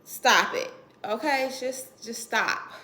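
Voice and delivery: loud, fed-up stop; loud exasperation. Acted. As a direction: tell them firmly to cut it out.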